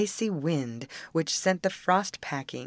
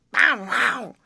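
high, loud hoarse barked words; hoarse dog bark; 7 semitones higher, 7 dB louder than usual. Acted. Chained dog showing his lost voice, hoarse bark. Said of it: "how hoarse I am".

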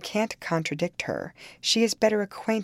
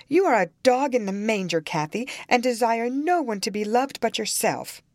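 quick, higher, mildly indignant burst; kindling up; 8 semitones higher, 3 dB louder than usual. A narrator's reading. Young woman accusing her sister-in-law of selfishness, heated. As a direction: flare up in accusation. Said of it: "kindling up".

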